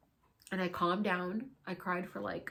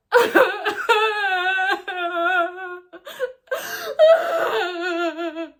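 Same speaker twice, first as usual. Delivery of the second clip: loud hammed-up sobbing wail, high; mock wailing laugh-cry; 11 semitones higher, 13 dB louder than usual. Retelling the ayahuasca ceremony, a loud mock wail. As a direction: wail it out like a fake breakdown. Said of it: "Wow, I'm a great actress".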